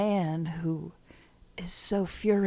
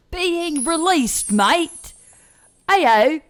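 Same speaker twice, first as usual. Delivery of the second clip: angry British man; 10 dB louder than usual. Pretend. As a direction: rant in a British accent about breakfast. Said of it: "I'm a British man who's angry about breakfast".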